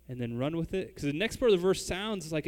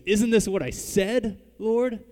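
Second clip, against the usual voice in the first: Jonah's angry complaint; 6 semitones higher, 6 dB louder than usual. Retold or imitated. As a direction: protest to God like a wronged man. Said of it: "you can hear the, the tone of his voice".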